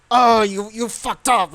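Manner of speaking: half-hearted imitation of an angry outburst; retold yelling. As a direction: act out someone yelling, held back. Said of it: "And he's, like, yelling".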